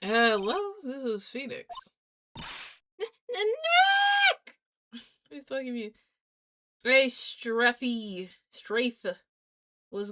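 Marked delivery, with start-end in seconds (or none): 2.4-5.0 very high, loud cry of Nick; girl in a panic yelling for Nick; frantic shout. Acted. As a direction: scream the name down the phone. Maya calling Phoenix urgently.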